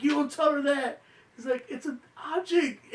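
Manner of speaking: high-pitched indignant protest; mock outburst. Husband erupting in court TV, mock outraged. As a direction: imitate someone erupting, voice jumping up high. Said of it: "the dude erupts in court T V".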